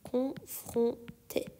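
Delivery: konfronte whispered, slowly; whispered repeat. Shown as a demonstration. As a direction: breathe the word out without voice. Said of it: "teacher repeats word whispered".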